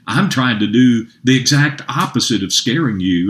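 normal talking voice

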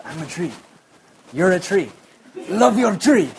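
building joyful cry. Retold or imitated. Preacher voicing the woman's triumphant tree lesson. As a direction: build each phrase up to a ringing final line.

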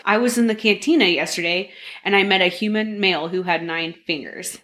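usual speaking voice

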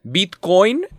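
"Bitcoin" with the second syllable stressed. Shown as a demonstration. Showing co-host the new accented word, stress on the O. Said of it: "con acento en la O".

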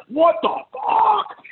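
acted high-pitched, drawn-out outrage; squealed, exasperated blow-up at normal volume; rage. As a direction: let mock fury boil over, stretching the words. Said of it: "all the rage and stuff comes out".

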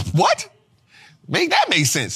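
shocked high What?; disbelief. Real, not acted. Teacher mocking the sell-at-a-loss excuse, in shocked disbelief. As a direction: jump way up in disbelief.

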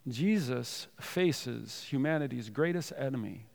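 ordinary talk

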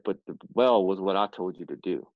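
low, firm retelling of God's words; God speaking. Retold or imitated. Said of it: "He said".

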